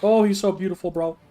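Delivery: loud high gushing; excited. Real, not acted. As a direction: gush over the cute crab. Gushing over crab.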